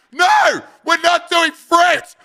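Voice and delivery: high-pitched, loud mock outburst; imitating Matt's shout. Matt rejects fruit mid-game, high exasperated mock shout. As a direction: shout the objection, drawn out and exasperated.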